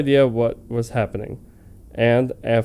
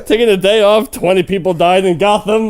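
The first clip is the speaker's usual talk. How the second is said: loud, high words broken by laughter; real laughter; laughing; 9 semitones higher, 6 dB louder than usual. Real, not acted. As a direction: keep restarting through the giggles. Laughing at the fanfic.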